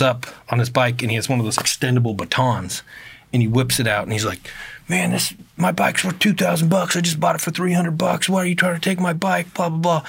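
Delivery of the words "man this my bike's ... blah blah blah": imitating a menacing man, pitched high throughout. Bike guy confronts suspected thief with baton, imitated menacing. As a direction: rant accusingly in a put-on high voice. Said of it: "He's, like, very menacing".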